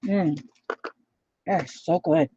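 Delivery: talking with mouth full; chewing. Real, not acted. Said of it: "I'm chewing".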